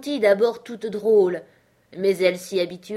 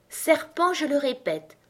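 high but less forceful accusation; slightly calmer insistence; 7 semitones higher than usual. A narrator's reading. Pigeon repeating itself to Alice, a little calmer. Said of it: "continua le pigeon d'une voix plus calme".

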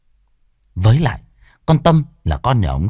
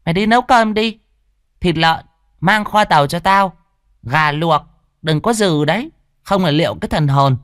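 high, sharp ordering voice of a cruel mistress; bossy stepmother; 9 semitones higher than usual. A narrator's reading. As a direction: bark orders at the maid from up high. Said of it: "Con Tâm quay sang bảo con Ở".

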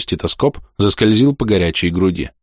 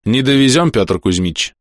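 brisk, firm, louder and higher verdict; quick and authoritative; 6 semitones higher, 3 dB louder than usual. Acted. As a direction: state it fast with full authority. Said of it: "быстро и авторитетно заявил доктор". (быстро и авторитетно)

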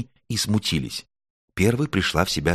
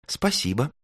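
high, shy thank-you; blushing little thanks, pitched up; pleased and embarrassed; bashful thanks; 8 semitones higher than usual. A narrator's reading. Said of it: "покраснела Звёздочка, то ли от смущения".

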